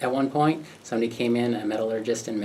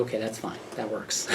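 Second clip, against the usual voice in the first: resigned murmur; 2 semitones lower, 10 dB quieter than usual. Retold or imitated. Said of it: "So I'm like".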